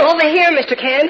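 high, loud answering call; calling back. Acted. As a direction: call back so he can find you. Calls back to the searcher.

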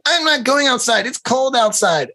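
put-on high defiant little girl; bratty child. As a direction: whine back like a spoiled kid. Child refuses.

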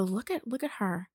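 hushed taunting whisper; whispered mocking. Ex whispering to his mom, mocking her. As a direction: whisper it snidely, like telling on her. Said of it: "and he'd go".

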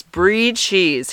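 "brie" said as "bree"; correct pronunciation. Shown as a demonstration. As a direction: say "brie" to rhyme with free. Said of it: "He'd call it brie".

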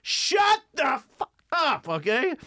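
comic yelling at rowdy shoe salesmen, furious shout; yelled. Retold or imitated. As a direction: yell at the hecklers to shut up. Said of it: "I yelled".

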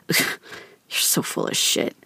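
slow, breathy hushed scoff; dad scoffing at his daughter's compliment, hushed and slow. Retold or imitated. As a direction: drop it to a scornful near-whisper. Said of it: "It was".

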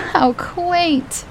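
high mock-delighted quip; amused condescension. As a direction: toss it off with a haughty lilt. Dora mocking.

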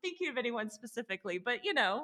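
amused. Real, not acted. Joking disclaimer, high pitch.

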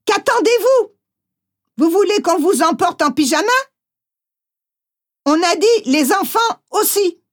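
high, fast rough hectoring; impatient officer. Acted. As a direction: rush them out the door, lightly voiced. Officer hustling the family out.

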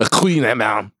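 hammed-up French pronunciation; silly faux-French accent; funny French accent. Shown as a demonstration. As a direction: repeat it in a comic French accent, pitch dropped. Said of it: "said that in a very funny accent".

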